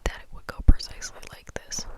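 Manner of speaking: usual speaking voice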